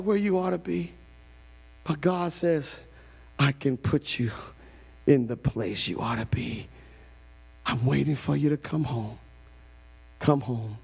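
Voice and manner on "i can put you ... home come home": tender voice of God. Acted.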